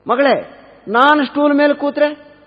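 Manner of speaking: high, slow, drawn-out challenge; insistent mother-in-law. Acted. Mother keeps quizzing the bride, slow high insistence. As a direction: stretch the question out high, testing her.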